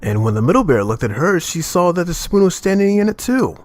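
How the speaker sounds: ordinary delivery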